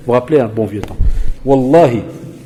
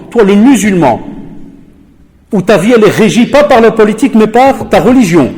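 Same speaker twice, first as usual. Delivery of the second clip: louder, higher preaching tone; rising rant; 7 semitones higher, 5 dB louder than usual. Real, not acted. Lecturer scolding fellow Muslims in a loud rant. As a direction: hammer it home like a sermon.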